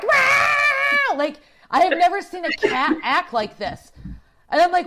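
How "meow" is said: high, loud yowling meow; shouted high cat meow; desperate meow. Retold or imitated. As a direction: yowl like a frantic caged cat. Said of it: "he's like".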